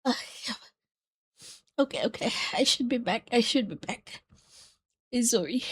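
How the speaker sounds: hoarse after coughing; coughing fit. Real, not acted. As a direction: speak between coughs, spent. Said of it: "shouldn't be coughing on everyone's ears".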